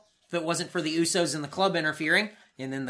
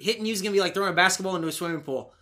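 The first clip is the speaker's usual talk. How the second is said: ordinary talk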